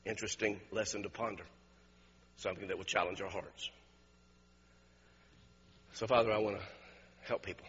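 low, soft, hushed praying; prayer. Real, not acted. Preacher praying to the Father for help, low and subdued. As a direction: pray quietly and sincerely, with pauses.

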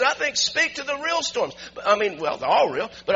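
high, loud, slow shouted preaching; preaching at full force. Real, not acted. Pastor exhorting church to speak to storms, shouting. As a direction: thunder it out to the congregation.

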